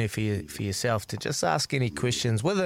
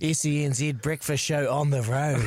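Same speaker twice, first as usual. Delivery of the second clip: laughing; 5 semitones higher than usual. Real, not acted. Host laughing at road trip idea.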